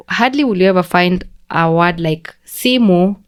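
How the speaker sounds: normal talking voice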